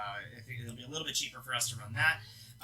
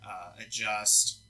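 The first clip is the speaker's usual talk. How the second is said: ordinary talk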